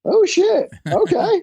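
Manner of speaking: high, amazed oh shit; squeaky mock astonishment; mock surprise. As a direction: play it up as if stunned by the news. Mock reaction to the page.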